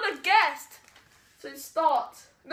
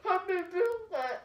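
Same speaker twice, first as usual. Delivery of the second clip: mouth full; 2 semitones higher, 7 dB quieter than usual. Real, not acted. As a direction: mouth stuffed with biscuits. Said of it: "Say it properly".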